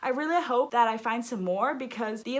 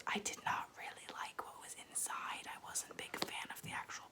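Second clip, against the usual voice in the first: whispered confession, much softer; 14 dB quieter than usual. Acted. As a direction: whisper it like a guilty secret. Confessing dislike of a pretty book.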